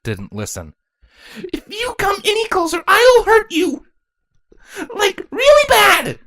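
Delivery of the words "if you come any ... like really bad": very high, loud, adorably fierce; a little kid trying to sound scary; small child's threat. Acted. Quelula threatens the ponies, a high child's bluster.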